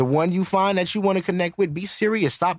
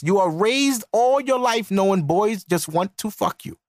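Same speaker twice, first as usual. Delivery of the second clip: ordinary talk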